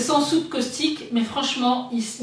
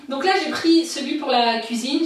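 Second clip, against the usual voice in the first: ordinary talk, nothing marked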